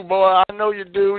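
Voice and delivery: ordinary talk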